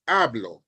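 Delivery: "hablo" stressed on "ha", HA-blo; first-syllable stress. Shown as a demonstration. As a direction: open "hablo" on its strong syllable. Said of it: "following the language rule".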